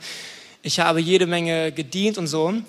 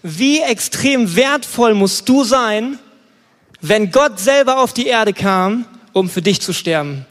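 loud, higher, emphatic sermon delivery; impassioned preaching; 4 semitones higher, 6 dB louder than usual. Real, not acted. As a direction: drive the point home to the congregation, loudly. Preaches at full force.

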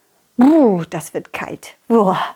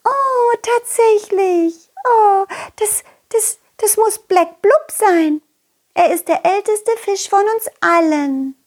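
high, slow breathy fish voice in wonder; awed little fish; 11 semitones higher than usual. Acted. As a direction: whisper the discovery like a tiny fish. Fish recognize the map.

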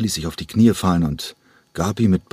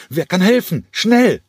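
very high, loud panicked yell; desperate high shout for a helper; 13 semitones higher, 4 dB louder than usual. Acted. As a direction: scream for help, climbing to the last word. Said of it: "Diesmal brüllte er. Panik lag in seiner Stimme".

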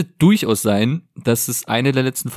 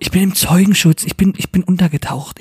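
raised, higher-pitched urgent protest; loud, agitated explaining; exasperated insistence; 8 semitones higher, 4 dB louder than usual. Acted. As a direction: insist over the other speaker, exasperated. Exasperated fugitive repeating himself.

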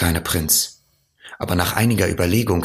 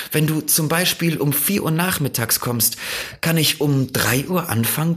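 higher, lilting fox voice; earnest fox; 10 semitones higher, faster than usual. A narrator's reading. Fox teaches the prince about waiting and happiness, earnest.

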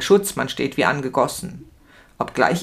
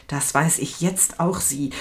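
softer, contemptuous reply under the breath; a touch of a hiss; 4 dB quieter than usual. A narrator's reading. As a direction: hiss it back at him, quieter. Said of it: "Ich zische". (Verhafteter zischt den Beamten leise und verächtlich an)

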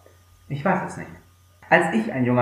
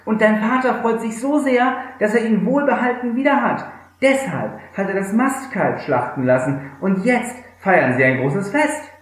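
eager servant; 8 semitones higher than usual. Acted. Servant reports the celebration.

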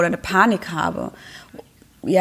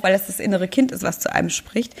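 ordinary delivery

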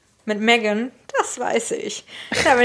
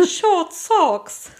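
little prince voice; 7 semitones higher than usual. Retold or imitated. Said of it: "perfekte Imitation von George".

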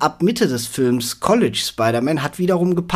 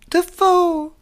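Dafoe squealed very high and loud; high squeaky shout; 4 dB louder than usual. Pretend. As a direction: shriek the name up high. How I Met Your Mother bit.

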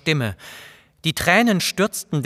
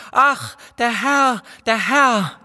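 much higher, slow, anguished wail of a maid; maid's scream; 8 semitones higher, slower than usual. A narrator's reading. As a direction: cry out in horror at the master's body. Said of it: "Das Dienstmädchen schrie".

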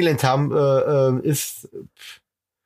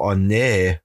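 dismayed groan; 8 semitones lower than usual. Retold or imitated. Quoting own dismayed thought.

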